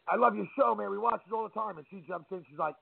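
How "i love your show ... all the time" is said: high, excited fan gush. Retold or imitated. Fan gushing to Chef Rob, high and excited.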